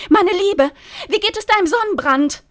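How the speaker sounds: high, loud gushing call. Acted. Rosi greeting Lynn across the terrace, high loud call. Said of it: "rief die kurze Rosi".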